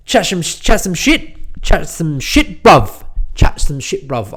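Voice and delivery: mock London geezer accent, lightly put on; chatting like a Love Island lad; English accent. Podcaster slipping into a playful London accent. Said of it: "can't get this, uh, English accent out of my brain".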